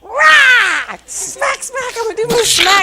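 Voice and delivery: high, loud frazzled outburst; mock rage. Stressed mom imagining snapping at the kids, loud and high. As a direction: explode like a fed-up parent. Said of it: "all I could think about".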